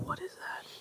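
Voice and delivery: hushed, breathy whisper; whispered question. Retold or imitated. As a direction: whisper it so nobody hears. Boss quoted, hushed.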